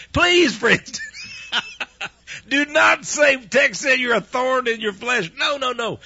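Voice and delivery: high, loud, half-laughing plea. Real, not acted. Preacher begging radio listeners not to quote him, loud laughing plea.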